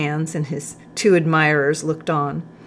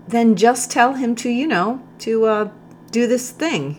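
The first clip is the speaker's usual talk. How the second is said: higher-pitched, halting hint; hinting hesitantly; 7 semitones higher than usual. A narrator's reading. Captain hinting to the corporal what to do, hesitant. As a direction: grope for words, voice lifted meaningfully.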